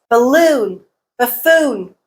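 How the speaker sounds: balloon and buffoon with LOON and FOON long and strong; second-syllable stress. Shown as a demonstration. Teacher giving exceptions, balloon and buffoon stressed on second syllables. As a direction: keep first syllables of balloon, buffoon weak, hit the second. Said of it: "Here are some exceptions".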